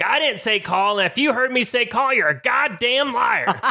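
high-pitched mock outrage; hammed-up old man's accusation, slowing on the last words; angry old guy. Old guy accusing a player of lying, high indignant imitation. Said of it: "he's like".

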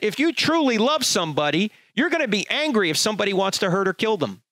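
normal talking voice

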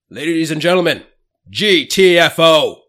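slow, high, booming call to the room; shouted, drawn-out bar-clearing call; loud closing announcement. Acted. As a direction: announce it like a ringmaster, spelling out each letter.

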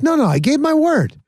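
high-pitched retold protest; insistent retort. Husband answers doubting wife, retold in high-pitched insistence. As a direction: push back firmly, pitched well up.

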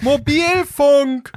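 Mobilfunk shouted high and very slow; frustrated yell. Retold or imitated. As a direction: bellow Mobilfunk at the dumb bot. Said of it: "eine Computerstimme und die sagt".

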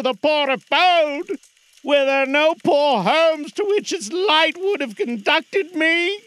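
high, slow, loud spectral lament; slow mournful ghost. Acted. Marley's ghost mourning to Scrooge. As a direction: stretch it out like a suffering spirit.

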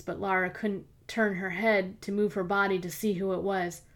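plain reading voice

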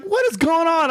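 high-pitched mock bewilderment; bewildered exclamation. Driver asking his screaming family, bewildered. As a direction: demand an explanation, voice jumping up.

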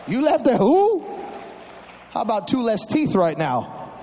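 put-on mom threatening the rude kids; angry mom. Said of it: "my mom would be like".

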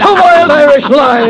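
loud, high angry denial; enraged officer refusing the news; furious outburst. Acted. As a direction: spit out the denial at full volume. Colonel rejects news of defeat.

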